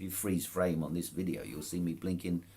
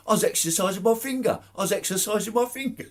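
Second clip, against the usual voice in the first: much higher, louder mock-innocent Cockney voice; cheeky Cockney retort; 8 dB louder, faster than usual. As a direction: play the cheeky Cockney lad, all innocence. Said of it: "He goes, he goes".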